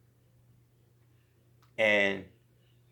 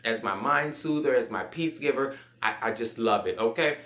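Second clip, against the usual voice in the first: ordinary talk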